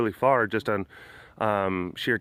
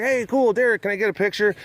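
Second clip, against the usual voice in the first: imitating excited fan; 8 semitones higher, 4 dB louder than usual. Fan greeting him excitedly.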